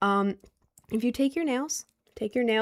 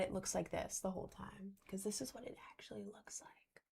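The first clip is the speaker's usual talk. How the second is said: soft voiceless whisper right into the camera; 18 dB quieter than usual. Real, not acted. Whispering to viewers up close about her makeup. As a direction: hush it like a secret.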